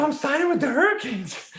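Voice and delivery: high, bursting with the big news; thrilled announcement. Retold or imitated. Player telling buddies on the porch he signed, thrilled and high.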